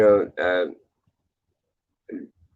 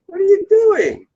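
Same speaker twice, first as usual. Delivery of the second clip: alarmed high voice; 7 dB louder than usual. Pretend.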